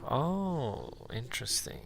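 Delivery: ordinary talk, nothing marked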